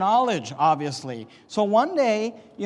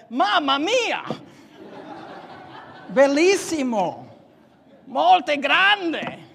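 hammed-up Italian; 6 semitones higher, slower than usual. Builder exclaiming in Italian to a confused worker, hammed-up. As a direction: gush like a stage Italian.

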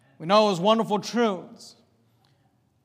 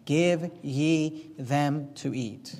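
solemn Jesus; 6 semitones lower, 4 dB quieter, much slower than usual. Acted. Jesus quoted from red letters.

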